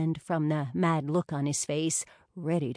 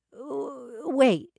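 stammered, higher wait; startled stammer; 7 semitones higher than usual. A narrator's reading. Rachel caught off guard by the plan, stammering. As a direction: trip over the word, startled.

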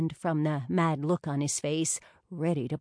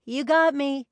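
playful; 8 semitones higher, 6 dB louder than usual. A narrator's reading. Tara admits playfully.

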